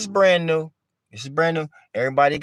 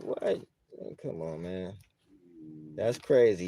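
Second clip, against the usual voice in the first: low, much softer mock muttering under the breath; muttered disbelief; 9 semitones lower, 10 dB quieter than usual. To the dog owner, a soft low mock grumble of disbelief. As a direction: mutter it like you can't believe your ears. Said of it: "You sitting here looking like".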